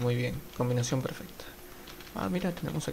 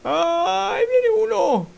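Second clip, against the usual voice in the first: high, loud frightened cry; shrill warning cry; panicked cry; 8 dB louder, much slower than usual. Real, not acted. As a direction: yelp in fear as an enemy appears. Spots an enemy approaching.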